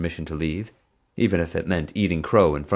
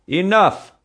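much higher, louder shout; 8 dB louder than usual. Acted. Park boss silencing the quarrelling pair with a shout. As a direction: cut everyone off at full voice. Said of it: "she shouted".

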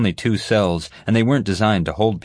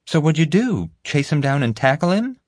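put-on much higher girl's voice; curious girl; 9 semitones higher than usual. Girl questioning the guard about the chase, curious. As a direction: pitch it up, quizzing him eagerly.

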